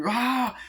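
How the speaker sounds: high yelp; retold high wail; mock pained cry. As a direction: imitate crying out while limping. Limping victim cries out.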